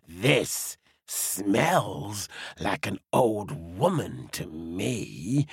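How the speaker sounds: low, slow, hungry wolf. Acted. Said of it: "the wolf said".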